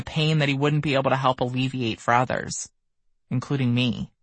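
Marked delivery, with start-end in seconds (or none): none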